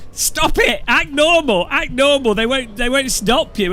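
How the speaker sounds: high, loud urgent scolding; exasperated high-pitched mock shouting; retold frantic yelling. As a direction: re-enact yelling at a panicking friend. Retold frantic orders to panicking friend.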